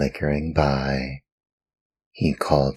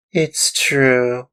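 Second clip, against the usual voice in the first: high, loud tearful admission; 10 semitones higher, 4 dB louder than usual. Acted. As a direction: confess it in tears like a small boy. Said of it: "cried Pinocchio in tears".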